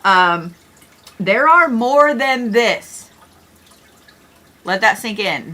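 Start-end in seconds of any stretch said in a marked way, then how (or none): 1.2-3.1 drawn-out, weighty slow delivery; slow emphasis. Real, not acted. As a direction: spell it out slowly so it lands. Driving home the huge number.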